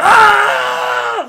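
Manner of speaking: long, high, very loud yell; imitated fan scream. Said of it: "that was like".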